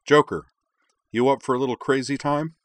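loud, high call of Joker; loud summons with a grin; calling over intercom. Acted. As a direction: hail the pilot over the intercom.

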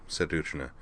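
ordinary delivery, nothing marked

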